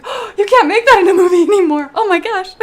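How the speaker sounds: loud, high-pitched shocked outburst; scandalized exclamation breaking into a laugh. Retold or imitated. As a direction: re-enact your shock at the screen, loud and high. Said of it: "just being really shocked".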